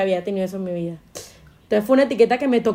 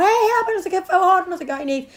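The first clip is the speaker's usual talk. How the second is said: mock quarrel call; 8 semitones higher than usual. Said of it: "cree que uno está peleando".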